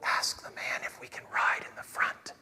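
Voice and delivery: slow stage whisper; hushed, conspiratorial whisper to a child. Retold or imitated. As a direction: whisper it slowly, word by word. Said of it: "I whisper to Dylan".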